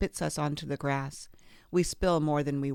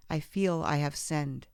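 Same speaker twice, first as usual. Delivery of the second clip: ordinary delivery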